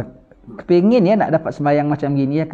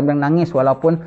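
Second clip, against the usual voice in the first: ordinary talk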